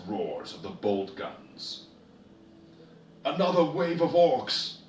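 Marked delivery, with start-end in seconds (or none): 3.2-4.8 high, loud alarmed cry; shouting warning. A narrator's reading. Darvis warning the squad of new orcs, loud shout. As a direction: yell the warning over the guns. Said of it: "Darvis shouted".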